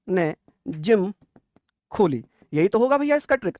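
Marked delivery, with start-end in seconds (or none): 0.1-2.2 drawn out, each word apart; slow it right down for the class; very slow dictation. Shown as a demonstration. Teacher spelling out the mnemonic, very slowly. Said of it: "अब आप ऐसे पढ़ना".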